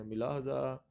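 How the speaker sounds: ملاحظہ with short i, mi-lahza; another misreading. Shown as a demonstration. Teacher quotes how others read it, demonstrated mispronunciation. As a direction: open ملاحظہ with mi-. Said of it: "کچھ اس کو پڑھتے ہیں".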